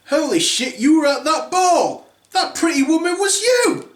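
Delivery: high, loud shocked outburst; shocked stepmother. Acted. Stepmother discovering Cinderella on Instagram, shocked and loud.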